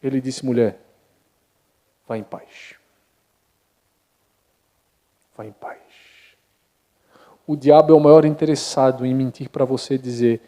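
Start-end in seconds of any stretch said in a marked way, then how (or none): 0.4-5.8 hushed, soft blessing; gentle Jesus, nearly whispered. Acted. Jesus softly sending the healed woman away in peace, nearly whispering. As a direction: breathe it out like a blessing.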